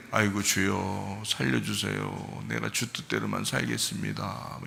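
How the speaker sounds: a sinner at the end of his strength, soft slow pleading prayer; desperate plea. Retold or imitated. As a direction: mimic a broken sinner begging quietly. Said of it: "이렇게 된다고요".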